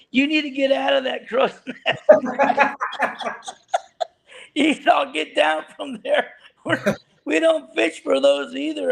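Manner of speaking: high-pitched order, breaking into laughter; laughing mock order. Skipper ordering angler out of crow's nest, mock scolding through laughs. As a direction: imitate the skipper while cracking up.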